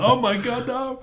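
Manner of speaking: high-pitched imitation of dismay; mock horrified reaction. Retelling a prank on the drummer, imitating his horrified reaction. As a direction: jump way up in pitch, aghast for comic effect. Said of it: "And he's like".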